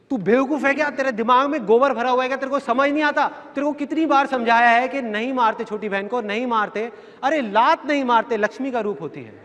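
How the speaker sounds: father scolding son for hitting his little sister, angry. Retold or imitated. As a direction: tear into the kid like a tired, angry father.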